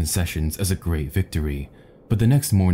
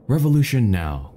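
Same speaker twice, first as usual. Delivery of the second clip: higher, half-raised slogan; lightly cried call to revolt; rally them without a full shout; professor's rallying cry; 6 semitones higher, 3 dB louder than usual. A narrator's reading. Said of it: "cried the professor".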